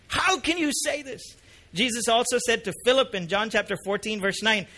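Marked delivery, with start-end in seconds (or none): 0.1-1.2 high-pitched incredulous question; strong, astonished how. Acted. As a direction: question it in mild shock. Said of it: "how strong this how is".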